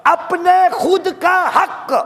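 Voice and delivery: high, loud and slow; the booming first take; loud emphatic declaration. Acted. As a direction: proclaim it to the whole hall. Preacher repeats phrase, loud then hushed.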